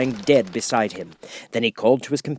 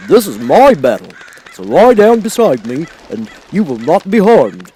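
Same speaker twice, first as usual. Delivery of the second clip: louder, higher heroic voice; brave scarecrow; 5 semitones higher, 9 dB louder than usual. Acted. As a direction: shield the girl, calm and sure. Scarecrow protects Dorothy.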